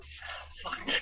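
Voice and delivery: a whispered fuck at the comments; whispered curse. Retold or imitated. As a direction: breathe it, unvoiced and defeated. Quoting self at criticism.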